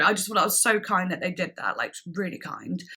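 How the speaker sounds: ordinary talk, nothing marked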